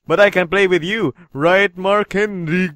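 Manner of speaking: low, soft villain slowing on the name. Acted. Game villain inviting the player by name, villain voice. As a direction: draw out the name as a quiet threat.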